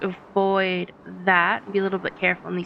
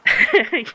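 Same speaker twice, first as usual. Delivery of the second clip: laughing; 5 semitones higher, 5 dB louder than usual. Real, not acted. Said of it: "You can say gobbler, [name], it's fine".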